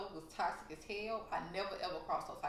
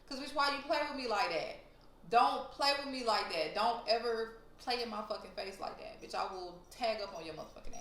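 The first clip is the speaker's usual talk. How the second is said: raised, higher-pitched scolding; retold angry confrontation; 3 semitones higher, 4 dB louder than usual. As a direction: re-enact laying into someone mid-fight, loud and indignant. Acting out yelling during the fight.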